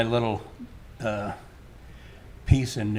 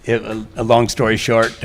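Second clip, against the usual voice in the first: projecting much louder; resume the story at raised volume; speaking up; 14 dB louder than usual. Real, not acted. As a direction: speak up so everyone hears. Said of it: "I have to speak up".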